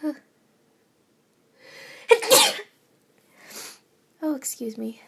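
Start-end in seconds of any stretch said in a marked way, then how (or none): 0.0-2.6 sneeze. Real, not acted. Sneeze bursts out.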